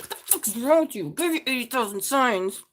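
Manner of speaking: hammed-up scolding ancestors voice; exasperated ancestors. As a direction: play the fed-up ancestors scolding. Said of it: "They're like".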